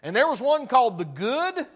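loud, high-pitched pulpit preaching; fiery preaching. Acted. Preacher to his congregation, fiery full-force preaching.

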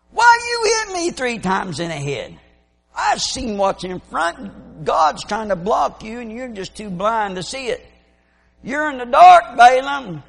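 loud high indignant scolding; scolding donkey. Retold or imitated. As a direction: chew him out for hitting her.

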